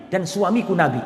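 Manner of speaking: ordinary delivery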